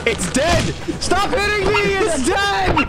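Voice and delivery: frantic high yelling; panicked shouting at a teammate; being chased. Real, not acted. Chased by monster in game.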